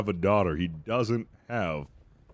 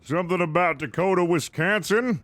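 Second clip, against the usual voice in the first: character voice; 9 semitones higher than usual. Acted. In-game character question.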